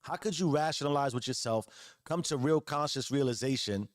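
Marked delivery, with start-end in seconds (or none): none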